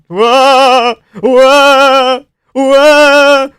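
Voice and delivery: high, loud, amazed whoas ending in a laugh; real shock at a brutal flurry; stunned whoa. Real, not acted. Watching a knockout flurry live.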